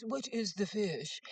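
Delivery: slow, drawn-out haughty question; snobbish jerk. Retold or imitated. To the waitress, drawn-out snooty question. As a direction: ask it slowly to make her squirm. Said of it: "trying to humiliate the waitress".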